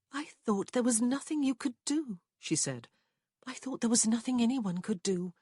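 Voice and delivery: lightly voiced regretful woman; Prue's voice. A narrator's reading. Prue explaining to Rowan why she stayed silent.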